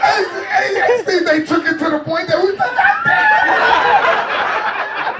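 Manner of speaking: high screaming excitement, laughing; excited shriek. Retold or imitated. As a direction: scream like a fan seeing a star. Everyone screams at Michael.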